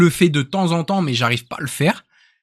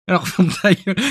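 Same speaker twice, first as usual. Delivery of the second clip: higher mock Québécois retort; Canadian French accent; 4 semitones higher than usual. Colleague shutting his pal up, mock Canadian French accent. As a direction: imitate a Québécois, amused. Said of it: "et l'autre, il fait".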